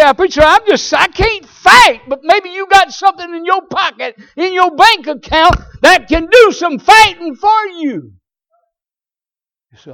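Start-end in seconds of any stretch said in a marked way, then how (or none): 0.0-8.1 high, loud pulpit cry; preaching shout. Acted. Preacher challenging listeners about their money, shouting. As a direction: throw it at the congregation.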